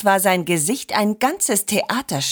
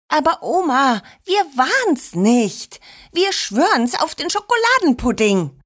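put-on higher, earnest child's protest; pleading protest; 5 semitones higher than usual. A narrator's reading. Children swear to Oma they're innocent, pleading higher. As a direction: plead innocence, stretched and high.

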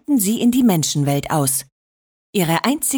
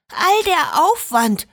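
hoch, langsam und ungläubig; fassungslos staunend; 9 semitones higher than usual. Acted. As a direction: hohe Wichtelstimme voller Staunen. Wichtelin staunt über gefundenen Hund. (ungläubig und hoch)

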